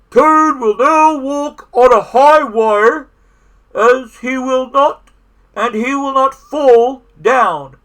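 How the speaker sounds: high, loud, slow announcement; stage announcer. A narrator's reading. The voice introduces Toad's high-wire act, slow loud announcer. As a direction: draw out each phrase for the crowd. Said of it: "said the voice".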